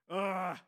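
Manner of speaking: a loud, drawn-out groan of reluctance; reluctant groan. Retold or imitated. Preacher groaning at the chore ahead, loud reluctant ugh. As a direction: groan like you don't want to get involved.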